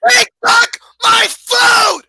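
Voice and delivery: high, loud, slow outrage; outraged shout. Real, not acted. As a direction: yell at the food thief. His wing was taken.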